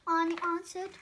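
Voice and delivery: usual speaking voice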